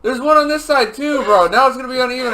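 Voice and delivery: high-pitched, loud protest; loud indignant complaint. Real, not acted. Man mourning his favourite plucked hair, loud indignation.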